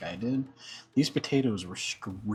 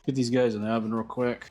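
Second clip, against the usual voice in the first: usual speaking voice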